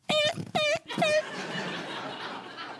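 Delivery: high rueful cackle; a high, self-mocking laugh. Real, not acted. Laughs at own heartbreak.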